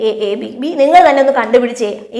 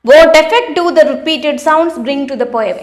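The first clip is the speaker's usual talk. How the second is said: reading the question out very slowly; slow, deliberate classroom read-aloud; slow textbook reading; 4 semitones higher, much slower than usual. Acted. Teacher reads textbook question.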